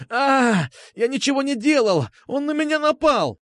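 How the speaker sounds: high, wailing yell of protest. A narrator's reading. Schoolboy blames his attacker to the guard, yelling. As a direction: cry out high in self-defence. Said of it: "заорал одиннадцатиклассник".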